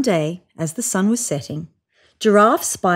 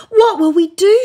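very high, loud panicked question; panicked cry; 12 semitones higher, 7 dB louder than usual. Acted. Hippo panicking to her neighbours, very high and loud. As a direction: cry out in alarm. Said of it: "she cried".

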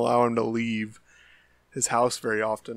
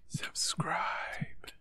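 intense hoarse whisper; whisper yelling; 3 semitones higher, 9 dB quieter, much slower than usual. Pretend. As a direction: hiss it urgently. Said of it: "Are we whispering?".

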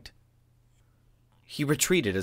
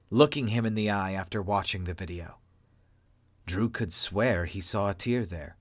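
ordinary delivery, nothing marked

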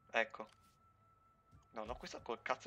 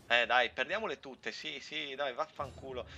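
italian angry cursing; 2 semitones higher, 7 dB louder than usual. Real, not acted.

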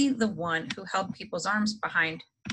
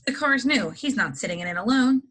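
sharp, louder and higher flat refusal; loud objection; 5 semitones higher, 4 dB louder than usual. A narrator's reading. Uncle refusing Harry a seat in his new car, loud and sharp.

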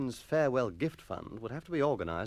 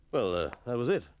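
sheepish schoolmaster; 3 dB louder than usual. Acted. Sheepish master.

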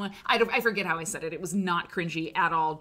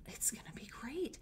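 whispered promise; 3 semitones higher, 11 dB quieter than usual. Real, not acted. Whispered aside to viewers.

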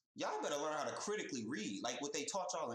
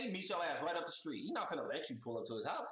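normal talking voice

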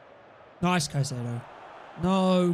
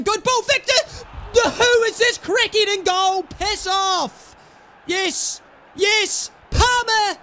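loud, high cheering shouts; goal celebration; 7 semitones higher, 4 dB louder, slower than usual. Real, not acted. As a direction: roar it like a goal just went in.

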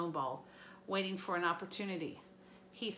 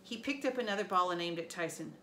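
usual speaking voice

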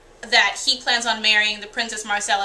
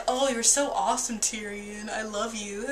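mock-adoring, trailing much softer; sappy mock girlfriend; 6 dB quieter than usual. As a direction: coo it like a lovestruck fan, fading out. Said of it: "she doesn't just be like".

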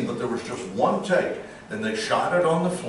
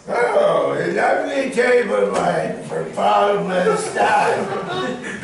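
put-on higher, loud, slow drunken Orson; drunk Orson Welles; 7 semitones higher, 5 dB louder, much slower than usual. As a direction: slur out a boozy wine ad. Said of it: "DiMaggio prefers drunk Orson".

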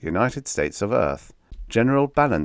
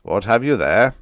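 louder, sharp question; 4 semitones higher, 7 dB louder than usual. Acted. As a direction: demand it impatiently. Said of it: "said General Ballantyne sharply".

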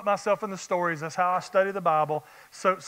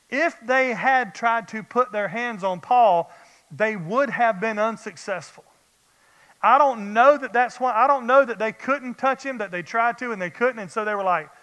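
preaching at full force; 2 semitones higher, 4 dB louder than usual. Acted.

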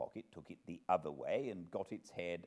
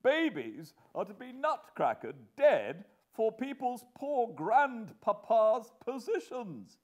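high, loud exasperated lament; helpless exclamation; 14 semitones higher, 10 dB louder than usual. Acted. Husband lamenting babies sacrificed for grandpapa, helpless. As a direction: wail it out in helpless outrage. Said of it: "he helplessly exclaimed to the elements".

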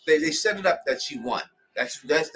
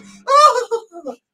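squealing laugh; 11 dB louder than usual. Real, not acted. Laughing at character collapsing.

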